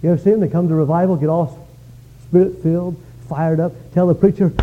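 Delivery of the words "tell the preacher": ordinary talk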